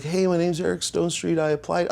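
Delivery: put-on eager kid voice. Young self calling clown college.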